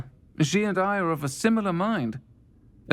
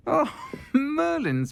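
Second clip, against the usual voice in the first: laughing; 12 semitones higher, slower than usual. Real, not acted. Fond Quidditch memory, laughing.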